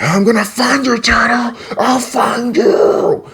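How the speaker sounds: high, strained mock screech; hammed-up monster cry, thin and stretched; villain monster threat. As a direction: screech the threat, drawing out the last words. Acting villain Snakeweed's threat.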